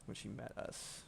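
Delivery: unvoiced whisper. Shown as a demonstration. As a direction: breathe it out as a whisper. Repeated phrase, whispered take.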